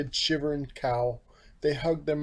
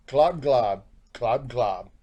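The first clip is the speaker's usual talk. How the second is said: gulping noises; 3 semitones lower, slower than usual. Acted. Crows eating noise.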